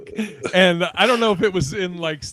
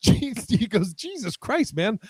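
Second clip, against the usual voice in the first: exasperated; 5 semitones higher than usual. Retold or imitated. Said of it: "Butch is like".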